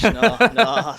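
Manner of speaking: laughing it off. Real, not acted. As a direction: say no through a laugh. Joking about the name Flesh.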